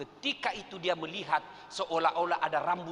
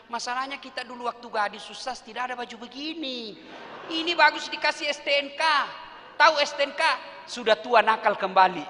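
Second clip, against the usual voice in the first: lightly voiced cheeky old woman, louder at the end; 7 semitones higher, 6 dB louder than usual. (suara nenek genit, ringan)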